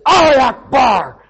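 slow, loud, high battle cry; imitated yelling. Man holding the pastor in a headlock, imitated yell. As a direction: shout it out, imitating an angry attacker. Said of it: "he's yelling at me like that".

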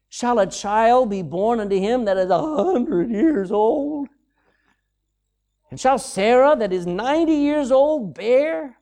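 drawn-out, rising disbelief; high, stretched-out incredulity; incredulous question. Acted. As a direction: ask it as if it's absurd. Abraham laughing at God's promise.

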